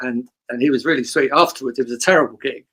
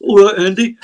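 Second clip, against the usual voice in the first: higher and louder, a chirpy greeting; 7 semitones higher, 6 dB louder than usual. Retold or imitated. Greeting [name] on a podcast, higher and louder. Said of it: "All of my impressions are someone saying".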